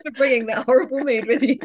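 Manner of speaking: loud, high, laughing jibe; laughing tease. Real, not acted. Host teasing guest about her bad mood, laughing. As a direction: cackle the punchline through a laugh.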